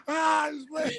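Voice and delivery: high, laughing repeat. Real, not acted. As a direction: crack up while saying it.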